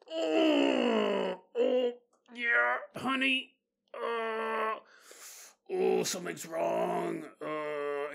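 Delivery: acted high, drawn-out groans of pain; in agony. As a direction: groan through the words, hamming up the pain. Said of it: "I'm having this horrific pain".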